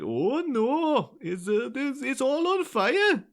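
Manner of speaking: high sing-song mock Welsh accent, slowing at the end; Fireman Sam. Co-host does a requested cartoon fireman, hammed-up Welsh accent. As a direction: put on an exaggerated Welsh lilt that drifts towards Indian. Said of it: "Just do a Fireman Sam impression".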